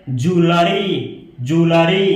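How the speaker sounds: ज्वेलरी slowly as "joo-luh-ree"; correct pronunciation. Shown as a demonstration. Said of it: "इसका right pronunciation है". (ज्वेलरी का सही उच्चारण, धीरे-धीरे)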